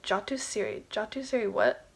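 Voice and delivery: very soft, puzzling to oneself; puzzled murmur. Retold or imitated. Woman lying in bed tests a phrase she heard, puzzled murmur. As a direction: repeat the strange words under your breath.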